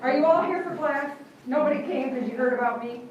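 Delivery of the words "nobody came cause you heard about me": usual speaking voice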